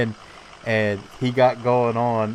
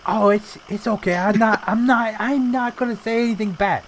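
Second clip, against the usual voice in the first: lightly voiced loud Italian guy; Italian guy impression; 11 semitones higher than usual. Pizza guy teasing the worker in the store, high mock voice. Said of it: "stereotypical loud Italian guys".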